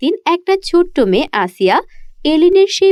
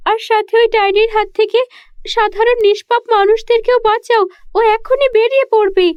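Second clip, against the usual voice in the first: put-on high little girl voice begging to be saved; a captive child urging the fairy to hurry; pleading girl; 8 semitones higher than usual. (ছোট্ট মেয়ের উঁচু গলায় কাকুতি)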